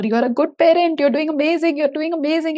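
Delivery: shouting reassurance at a crying mother; shouting encouragement. Retold or imitated. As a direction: cheer her on loudly. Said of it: "I was shouting to her".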